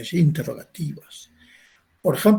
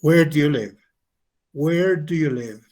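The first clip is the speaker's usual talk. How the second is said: "Where do you live" with the tune falling to the end; falling intonation; 5 dB louder than usual. Shown as a demonstration. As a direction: let the pitch drop on "live". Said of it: "la entonación cae".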